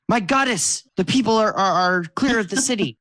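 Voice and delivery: kobold voice pitched well up, fast and urgent; a small kobold knight rushing in with news; eager little kobold. Acted. Kobold soldier reporting to his goddess, high and hurried. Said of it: "and goes".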